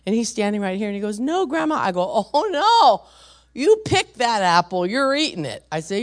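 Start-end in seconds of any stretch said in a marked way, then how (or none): 1.2-1.7 lightly voiced little boy, pitched up; high-pitched mock child's refusal; small child's protest. Voices grandson refusing before police. 2.3-5.6 high, loud grandma's telling-off; exasperated mock scolding, drawn out.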